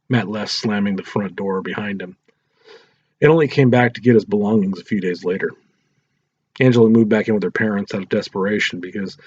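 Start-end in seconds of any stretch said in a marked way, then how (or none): none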